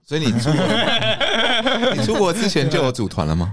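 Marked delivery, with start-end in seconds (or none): 0.0-2.0 laughing. Real, not acted. Laughs mid-question.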